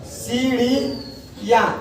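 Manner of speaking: सीढ़ियाँ drawn out, each syllable held; very slow demonstration. Shown as a demonstration. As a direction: stretch सीढ़ियाँ for the class. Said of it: "पहले इसे देखो क्या लिखा है". (सीढ़ियाँ बहुत धीमे खींचकर)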